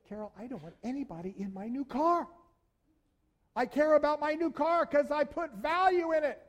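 to his wife Carol about the car, a hint of indignant insistence; possessive complaint. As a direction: act out a fussy new car owner. Retold quote to wife Carol.

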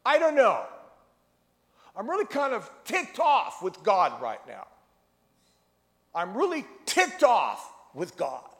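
high, loud, frustrated complaint; a young man shouting his frustration at God; angry young man. Retold or imitated. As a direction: vent your anger at God loudly.

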